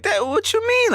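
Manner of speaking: high, baffled question; taken aback. Retold or imitated. Reacting to his friend's doubt, high baffled. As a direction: sound caught off guard. Retold reaction in money argument.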